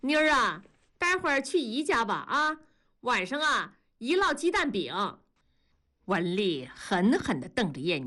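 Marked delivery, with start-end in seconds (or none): 0.0-5.2 put-on high, sweet coaxing to a child; warm coaxing. A narrator's reading. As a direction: lure the little girl with a treat. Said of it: "热情地跟燕妮打招呼".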